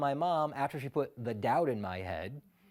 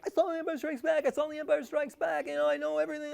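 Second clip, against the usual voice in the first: much higher, proud bragging; boastful kid; 8 semitones higher, faster than usual. Retold or imitated. Kid bragging to schoolmates, high excited boast. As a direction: show off to the whole school. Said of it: "I'm like".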